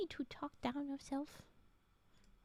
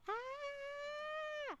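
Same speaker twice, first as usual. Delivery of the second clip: silly high voice; 11 semitones higher, 4 dB quieter than usual. Pretend. Joking about the ad pun.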